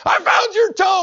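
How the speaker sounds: high, loud, proud shout; loud announcement. Retold or imitated. Neighbor bursting in, proudly shouting to the patient. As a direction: blurt the good news. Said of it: "As soon as he said".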